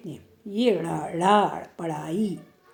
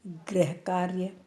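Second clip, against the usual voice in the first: गृह कार्य repeated softly, lower and slow; soft slow echo; 4 semitones lower, 7 dB quieter, slower than usual. Shown as a demonstration. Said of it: "soft repeat". (गृह कार्य को धीमे स्वर में धीरे दोहराना)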